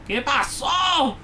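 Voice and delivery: high, drawn-out yell; exasperated shout. Real, not acted. Player pestered by the game, exasperated shout. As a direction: shout it out, stretching the last word.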